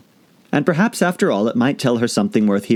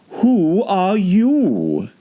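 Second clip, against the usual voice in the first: higher, very slow creature voice; slow lofty caterpillar; 11 semitones higher, much slower than usual. A narrator's reading. Caterpillar questioning Alice, high and very slow. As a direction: draw it out haughtily.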